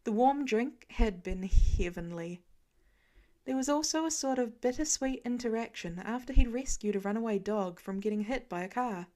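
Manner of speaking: ordinary delivery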